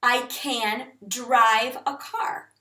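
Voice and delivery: slow, deliberate example sentences. Shown as a demonstration. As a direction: say them slowly so learners follow. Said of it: "For instance".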